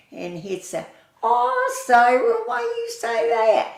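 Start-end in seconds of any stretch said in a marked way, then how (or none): 1.2-3.7 high, loud retold protest; mock protest. Leon to Sarah over their little joke, mock protest. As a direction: imitate a friend protesting a teasing jab.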